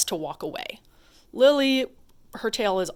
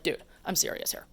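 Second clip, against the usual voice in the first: whispered, serious warning; quietly mean it; 2 semitones lower, 8 dB quieter than usual. Shown as a demonstration. Trainer showing her calm serious correction, whispered. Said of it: "just becomes a little more serious".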